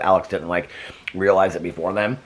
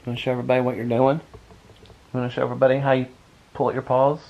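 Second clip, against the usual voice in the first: pet talk; 5 semitones higher, faster than usual. Real, not acted. Owner coaxing her dog to perform for viewers, higher. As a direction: coax the pup to show off.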